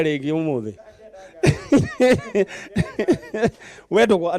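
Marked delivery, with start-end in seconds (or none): 1.4-3.5 long, high burst of real laughter; hearty laugh. Real, not acted. Host laughs after joking with contestant.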